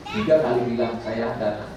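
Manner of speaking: ordinary talk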